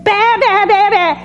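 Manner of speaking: high repeated sheep baas. Retold or imitated. Preacher wonders if the sheep had cried, high sheep baas. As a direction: baa like a sheep calling out. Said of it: "If that sheep had been".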